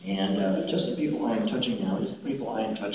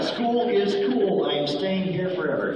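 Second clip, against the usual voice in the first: demonstrated shout; 6 semitones higher than usual. Shown as a demonstration. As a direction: model the cheer for them to copy. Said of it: "You're going to shout".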